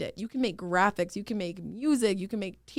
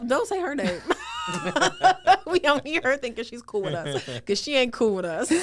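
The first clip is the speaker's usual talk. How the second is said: talking through real laughter; amused, raised voice breaking into laughs; laughing protest; 7 semitones higher, 5 dB louder than usual. Real, not acted. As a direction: protest while cracking up. Laughs at interviewer suggesting Nancy Pelosi.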